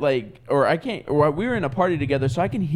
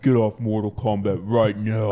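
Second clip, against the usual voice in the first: low menacing order; 6 semitones lower than usual. Retold or imitated. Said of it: "And I was like".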